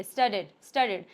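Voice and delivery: "स्टडीड" with a clear final d; clear d sound. Shown as a demonstration. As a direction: demonstrate "स्टडीड", falling, ending on d. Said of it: "आप डी का sound बोलिए".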